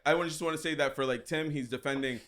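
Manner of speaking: ordinary delivery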